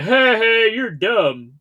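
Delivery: loud, high mocking heckle; mock jeering. Host showing how he doesn't mean to sound to a chatter, mock jeering. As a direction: put on an exaggerated jeer, starting loud and high. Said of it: "I'm not trying to be like".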